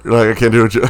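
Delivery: ordinary talk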